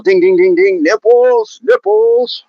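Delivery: high-pitched singsong call to dinner; dinner bell call. Pretend. Streamer jokes viewers come running, a high dinner-bell call. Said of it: "It's like the dinner bell".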